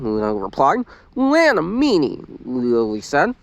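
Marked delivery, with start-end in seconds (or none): 1.2-2.2 put-on high, slow toddler voice; little girl voice. A narrator's reading. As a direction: babyish and slow.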